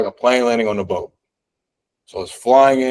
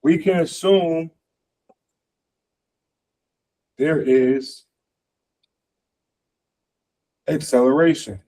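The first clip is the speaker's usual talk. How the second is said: drawn out, with long pauses between phrases; slow dictation; 4 semitones higher, slower than usual. Real, not acted. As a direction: spell the conclusion out slowly, piece by piece. Repeats it slowly, as if writing.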